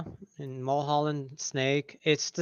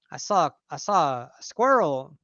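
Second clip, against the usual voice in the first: put-on light Southern accent, joking; mock Southern; 4 semitones higher than usual. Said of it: "he would talk like that".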